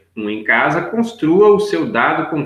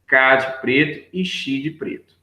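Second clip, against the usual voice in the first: slow, pointing out each syllable; walk the class through it slowly; slow, careful teacher voice; slow syllable demo. Shown as a demonstration. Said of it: "Olha pra você ver".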